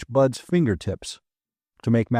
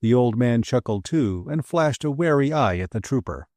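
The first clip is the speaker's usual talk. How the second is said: ordinary delivery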